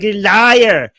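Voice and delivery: loud, drawn-out jeering cry; mock accusation. Mimicking people who call the reciter a liar, loud mock accusation. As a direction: imitate a crowd shouting an accusation. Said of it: "People are like".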